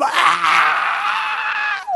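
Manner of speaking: very high, piercing, long scream; shrieking little brother, acted out; imitated baby wail. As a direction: mimic a one-year-old screaming. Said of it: "And Jacob's like".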